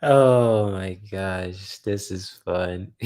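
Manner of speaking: usual speaking voice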